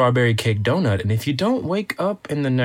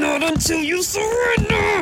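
playful shout. Acted. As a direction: yell it while winning the wrestle. Playful wrestling, pinning friend down.

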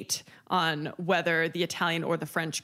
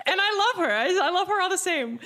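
laughing affection; 10 semitones higher, 3 dB louder, faster than usual. Real, not acted. Laughing about friend Jen.

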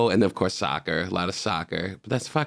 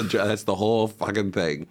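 normal talking voice

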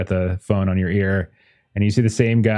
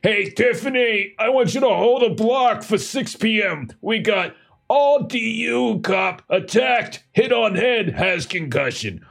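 calling across the office; 10 semitones higher than usual. Acted. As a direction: sing out the order to the assistant. Editor calls out to assistant.

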